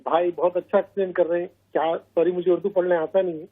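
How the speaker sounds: soft, under-projected caller voice; faint voice. Real, not acted. Caller praising the show and asking the guest's name, faint voice. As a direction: talk quietly, barely carrying down the line. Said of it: "آپ کی آواز کم آ رہی ہے تھوڑی".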